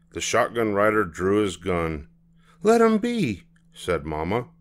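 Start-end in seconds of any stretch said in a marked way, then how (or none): 2.6-3.4 mother voice. Acted. Mama voiced.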